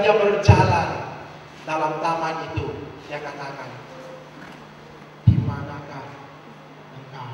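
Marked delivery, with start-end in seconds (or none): 5.2-7.3 full of love. Shown as a demonstration. God searching for Adam and Hawa with longing, tender whisper. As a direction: breathe it like a lover missing the beloved. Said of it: "Dengan penuh kasih".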